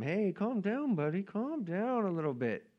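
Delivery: soft, sing-song mock soothing; hushing warning. Bystanders telling the blind man to settle, soft mock hushing. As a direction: shush him gently, pitch lifted. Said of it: "people warn him".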